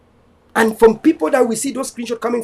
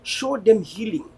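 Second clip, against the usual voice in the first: softer, earnest closing prayer; 4 semitones lower, 4 dB quieter than usual. Real, not acted. Leader praying for fellow Ambazonians, softer and earnest. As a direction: pray over your people, hushed and sincere.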